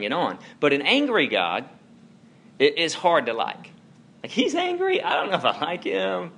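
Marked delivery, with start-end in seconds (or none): 4.2-6.3 high, unsure voice of a doubting listener; doubting churchgoer. Retold or imitated. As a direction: sound unsure whether to like God.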